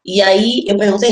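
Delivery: usual speaking voice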